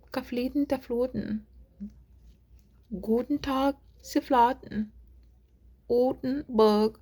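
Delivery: amateur German accent, word by word; attempted German accent. Shown as a demonstration. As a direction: playfully try out German-sounding words. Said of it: "I try to just do an accent a little".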